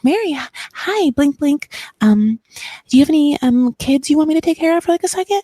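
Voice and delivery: high, sugary, fluttery voice with little stammers; fake sweet Robin. Robin buttering up Mary, high and sugary. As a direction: play the simpering suck-up, eyelashes batting. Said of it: "that entire reenactment".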